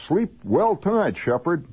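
high, ringing mock-solemn delivery; grand declamation. Soldier writing to radio host, high grand declamation. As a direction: intone it like a lofty pronouncement.

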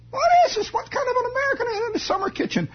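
very high, rushed, incredulous squawk; mock outrage. Scolding a listener about summer kitchens, high mock outrage. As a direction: scold fast in squeaky put-on disbelief.

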